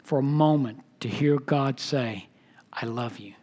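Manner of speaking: hushed, unvoiced awe at God's love; breathless whisper. Real, not acted. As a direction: whisper it as if breath is taken away. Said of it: "Doesn't it take your breath away".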